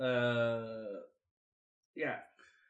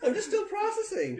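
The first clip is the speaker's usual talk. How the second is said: laughing. Real, not acted. Overwhelmed by character change.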